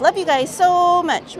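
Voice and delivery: high, loud, slow gushing love. Pretend. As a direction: stretch it out sweetly. Reading a loving chat message.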